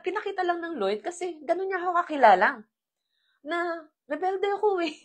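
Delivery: ordinary delivery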